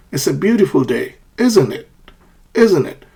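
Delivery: "isn't it" starts high and falls; falling tag question. Shown as a demonstration. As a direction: sure of the answer, drop "isn't it" like a statement. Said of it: "a falling intonation is used".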